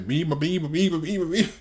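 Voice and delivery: laughing imitation of a rattling stammer; imitating stuttering. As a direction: mimic someone stuck on one syllable. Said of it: "When he starts stuttering".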